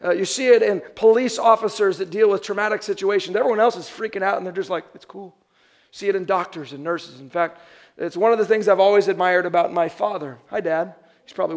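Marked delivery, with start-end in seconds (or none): 4.9-5.4 very soft, cool aside; hushed, unbothered reassurance; unfazed calm. Retold or imitated. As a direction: play it down almost under the breath. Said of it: "I see this kind of calmness".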